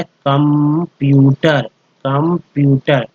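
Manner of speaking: Computer drawn out slowly, syllable by syllable; slow pronunciation demo. Shown as a demonstration. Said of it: "CU को कम बोलते हैं". (Computer धीरे-धीरे बोलकर)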